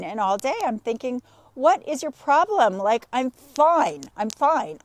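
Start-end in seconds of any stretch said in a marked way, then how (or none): 1.5-4.8 loud mock-irritated protest; hammed-up exasperation with drawn-out emphasis; exasperated. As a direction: insist you're fine, clearly annoyed. Acts out her irritated thought.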